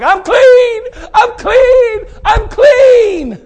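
overjoyed shouting, voice breaking into a cry; ecstatic cry. Acted. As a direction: cry out like a man just healed. Healed Naaman bursting from water.

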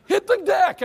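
loud, high yelled warning; urgent shout. Retold or imitated. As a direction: yell at everyone to get down. Dad yelling warning to family.

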